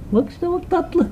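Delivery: very high, soft squeal of delight; squeaky high pet voice, hushed. Real, not acted. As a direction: gush over a cute puppy. Cooing over the cute puppy.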